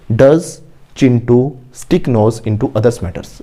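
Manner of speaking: very slow, word by word, for students to repeat; slow model sentence. Shown as a demonstration. Teacher modelling an answer for the class, very slowly. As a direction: spell each word out slowly like a dictation.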